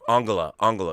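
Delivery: Angela with a hard g and open first vowel; German pronunciation of Angela. Shown as a demonstration. Correcting himself to the German chancellor's own Angela, German pronunciation. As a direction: say Angela as "AHNG-guh-la". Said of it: "it's Angela, right?".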